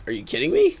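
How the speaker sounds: high, loud retold disbelief; retold incredulity. Employee answering his boss about company culture, incredulous. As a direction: act out an incredulous comeback. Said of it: "he's just like".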